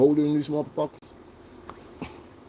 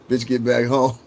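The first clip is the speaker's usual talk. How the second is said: ordinary talk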